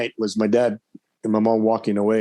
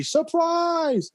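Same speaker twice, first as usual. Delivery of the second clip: high mock party cry of surprise; sing-song surprise greeting; surprise shout. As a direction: call it out like the guests did.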